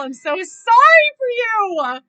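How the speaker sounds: loud, high, drawn-out lament; anguished sympathy. Real, not acted. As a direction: wail your pity for him slowly. Pitying character after horror scene.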